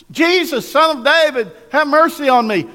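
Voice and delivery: high, loud cry to Jesus; shouting. Acted. Blind man begging Jesus for mercy, shouting. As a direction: call out loudly over a noisy crowd. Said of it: "he began to shout".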